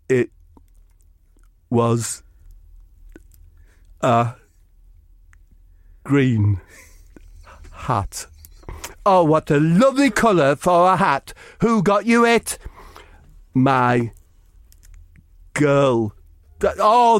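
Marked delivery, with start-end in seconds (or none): 0.1-8.3 dim slow Eric voice. Acted. Eric again. 13.5-16.1 dim slow Eric voice. Acted. Eric again.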